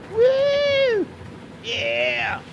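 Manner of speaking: high, loud celebratory whoop; excited cheer. Real, not acted. Streamer celebrates mats at a hundred, high excited cheer. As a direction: whoop high, then cheer a drawn-out yeah.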